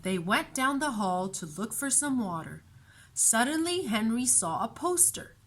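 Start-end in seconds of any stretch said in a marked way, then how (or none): none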